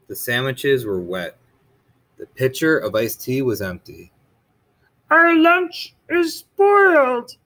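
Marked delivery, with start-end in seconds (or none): 5.1-7.4 high, slow, louder dismayed Toad; Toad's voice. Acted. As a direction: lament the soggy lunch, high. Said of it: "said Toad".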